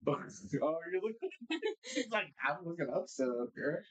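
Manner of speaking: ordinary talk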